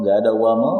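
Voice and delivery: ordinary talk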